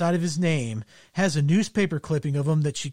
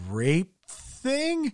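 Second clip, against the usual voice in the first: taken aback; 8 semitones higher, 3 dB quieter than usual. Retold or imitated. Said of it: "taken aback and is like".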